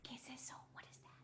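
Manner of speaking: whispered question; hushed curious whisper. Acted. Asks softly like to a child.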